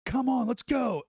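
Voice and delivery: high, loud urging call; impatient call. Retold or imitated. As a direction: hurry the others along, loud. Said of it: "He's like".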